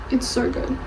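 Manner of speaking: sighed, breathy gush. Real, not acted. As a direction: sigh the words out. Sighing after gushing.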